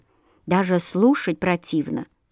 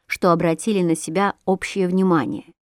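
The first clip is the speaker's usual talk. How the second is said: ordinary delivery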